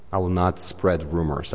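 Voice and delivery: ordinary delivery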